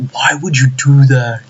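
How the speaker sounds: slow, drawn-out lament; exasperated. Real, not acted. Fan lamenting the removed one-inch punch, exasperated. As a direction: stretch the words in dismay.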